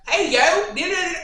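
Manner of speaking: loud, high put-on holler; mock playback voice. Imitating a voice note played back, loud mock holler. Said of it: "And next be like".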